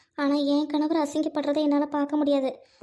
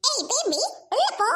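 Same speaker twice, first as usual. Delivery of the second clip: put-on higher, sharp command to the child; sharp order; 11 semitones higher than usual. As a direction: shoo the baby inside. Sister orders child inside.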